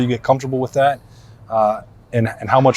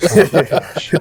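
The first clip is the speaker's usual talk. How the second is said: higher, real laughing reaction; 5 semitones higher, slower than usual. Real, not acted. Reacting to guest's joke about referees, laughing. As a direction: crack up while exclaiming.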